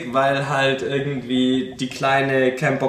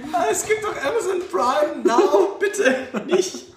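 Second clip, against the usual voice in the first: high pleading voice; 12 semitones higher than usual. Pretend. Playing the sister's plea.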